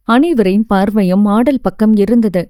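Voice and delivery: plain reading voice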